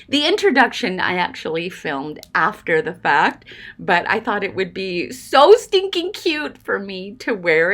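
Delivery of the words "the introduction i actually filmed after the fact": ordinary talk, nothing marked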